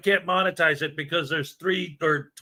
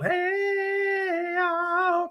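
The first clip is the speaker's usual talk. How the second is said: a squeaky cartoon-high hey y'all, far above his own; 14 semitones higher, 4 dB quieter than usual. Streamer greeting a viewer in a squeaky chipmunk voice.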